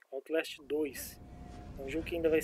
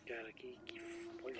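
scared whisper in the dark; 4 semitones lower, 12 dB quieter than usual. Real, not acted. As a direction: breathe it out, spooked. Spooked streamer whispering. (sussurro assustado)

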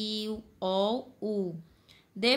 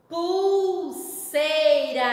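pulseira drawn out very slowly and a bit louder; slowly stretched word; 4 semitones higher, 6 dB louder, much slower than usual. Shown as a demonstration. Teacher to children, slowly spelling out pulseira. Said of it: "Olha o u na palavra".

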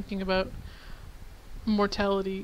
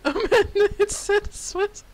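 aghast outcry; 11 semitones higher, 6 dB louder, slower than usual. Real, not acted. Dad shocked at daughter's message.

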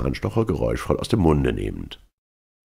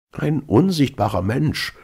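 high, slow exclamation; exclaiming; 10 semitones higher, 3 dB louder than usual. A narrator's reading. Marvel reacts to news of the invisible man, exclaiming. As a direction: cry it out high, drawing the words out. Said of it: "rief Mister Marvel".

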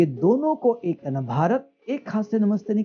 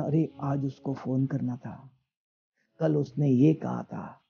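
low, softer inner-voice aside; stray thought; 5 semitones lower, 6 dB quieter than usual. Acted. Teacher acts the intellect remembering a phone call, in a low aside. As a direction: voice a distraction quietly, as if thinking to yourself. Acting out intruding thoughts during meditation.

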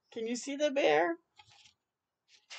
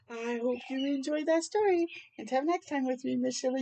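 usual speaking voice

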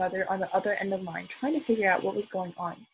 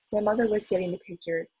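ordinary delivery

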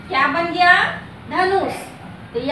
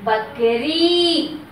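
बकरी stretched very slowly, falling at the end; teacher drawing out बकरी; slow model word; much slower than usual. Shown as a demonstration. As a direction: sound out बकरी for the children. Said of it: "क्या बना?".

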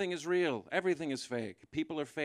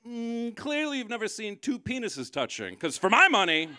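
much higher, knowing mock tone; higher smug comeback; smug sarcasm; 9 semitones higher, 5 dB louder than usual. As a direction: tease him with mock wisdom. Sarcastic retort to heckler.

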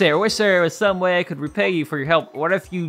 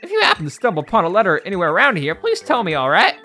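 lively, higher-pitched Southern postman; Southern accent; 7 semitones higher, 5 dB louder than usual. Acted. Flustered postman apologises to Mario over a lost letter, Southern accent. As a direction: voice a folksy Southern letter carrier, pitch swooping up. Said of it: "like, a southern accent".

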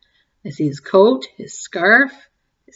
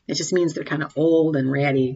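plain reading voice

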